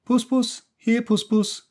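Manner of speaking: high, loud coaxing call; boy calling Puss Puss high and loud; calling the cat. A narrator's reading. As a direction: call sweetly to a stray cat. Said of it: "he called".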